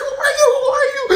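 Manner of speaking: very high mock panicked shriek; startled shriek. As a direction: shriek at a sudden intruder. Startled naked sister kicking intruder.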